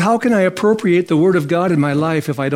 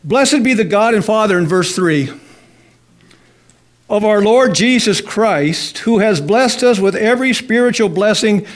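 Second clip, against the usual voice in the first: scripture reading; 4 semitones higher than usual. Acted.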